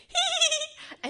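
very high, loud imitated "hee hee"; child's mischievous giggle. Little boy turning round to his mother, gleeful giggle. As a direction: squeal it like a gleeful toddler. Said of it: "he turns around and goes".

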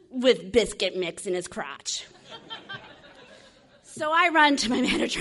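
loud, laughing narration; laughing while telling. Real, not acted. Recounting the disgusting scene, laughing loudly. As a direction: push the words through laughter.

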